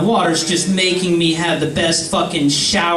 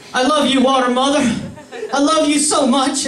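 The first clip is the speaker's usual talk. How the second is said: high, worshipful declaration; hammed-up devotion; gushing adoration; 7 semitones higher than usual. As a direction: profess love in a raised, swooning voice. Acted adoration of water mother.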